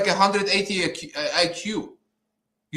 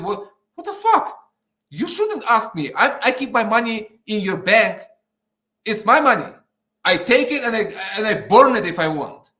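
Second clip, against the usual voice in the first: angry rant; 3 semitones higher, 4 dB louder than usual. Real, not acted. Man protesting bank questions about his money, angry rant. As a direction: push each claim out with heat. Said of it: "It just makes me so angry".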